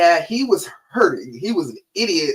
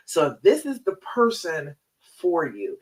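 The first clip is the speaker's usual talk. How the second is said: ordinary delivery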